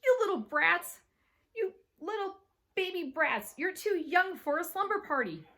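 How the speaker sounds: loud, high-pitched scolding; hollered scolding. A narrator's reading. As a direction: holler down at the little kids. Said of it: "She hollered".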